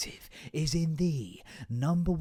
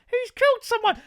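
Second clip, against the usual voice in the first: squeaky fish voice; 8 dB louder than usual. Pretend. Fortune fish reveals secrets.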